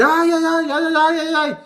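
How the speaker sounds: ayes muy agudos y fuertes, imitando al niño; grito de pánico agudo. Retold or imitated. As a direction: chilla como un niño aterrado. Niño grita en la montaña rusa. (grito agudo de niño asustado)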